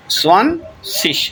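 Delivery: slow teaching demo of سوانسش with final sh. Shown as a demonstration. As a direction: split سوانسش after سوان, closing on sh. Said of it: "یہ شین کی آواز دے گا".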